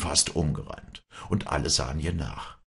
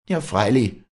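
raised, louder hateful retort; bursting hatred; 8 semitones higher, 7 dB louder than usual. A narrator's reading. Village woman retorting to her rival, with hatred. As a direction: let a touch of hatred break out. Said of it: "rief die Walli mit ausbrechendem Hass".

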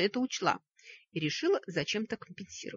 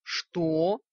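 indignant; 6 dB louder than usual. Acted. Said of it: "возмущённо выдохнул Вик".

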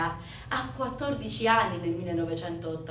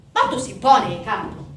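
exasperated refusal; 9 dB louder than usual. Acted. Brother refuses in acted quarrel.

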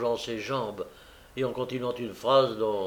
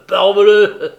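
loud high burst of bravado; beery exclamation; 8 semitones higher, 16 dB louder than usual. A narrator's reading. Noé échauffé par la bière, crie à Charlotte. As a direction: shout it, warmed by beer. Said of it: "que la bière échauffait".

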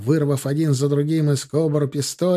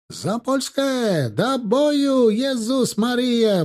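high, drawn-out war cry; battle cry; 9 semitones higher, much slower than usual. A narrator's reading. Polish lancers charging, fervent battle cry. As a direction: send the cry ringing out before a charge. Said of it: "послышался клич".

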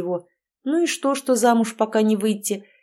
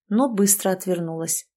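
ordinary delivery

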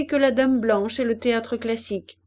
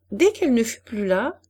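ordinary delivery, nothing marked

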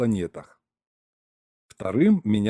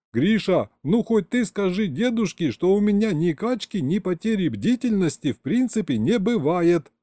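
high, whiny appeal; indignant pleading; 8 semitones higher than usual. Acted. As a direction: beg a friend to back you up. Indignant appeal to Grisha for support.

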